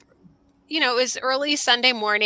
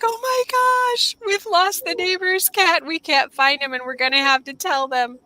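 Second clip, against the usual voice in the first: upset; 7 semitones higher than usual. Retold or imitated. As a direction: act out distress over a lost cat, starting high. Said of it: "so upset".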